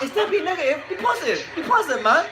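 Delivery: very high-pitched mock British pep talk; hammed-up British boxer, pitched right up; O'Hara Davis imitation. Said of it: "this is my O'Hara Davis imitation".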